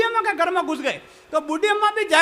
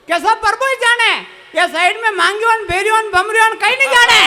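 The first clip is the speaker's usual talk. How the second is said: louder exasperated rant; loud retort; 4 semitones higher, 5 dB louder than usual. Retold or imitated. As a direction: throw up hands and rant. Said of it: "भाई बोले".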